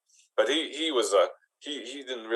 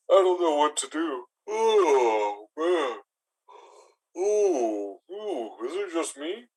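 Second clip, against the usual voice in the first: mock yawning; 5 semitones higher, much slower than usual. Goblin lady unsure about her suitor, acting out big yawns. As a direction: talk through drawn-out fake yawns. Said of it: "am I yawning".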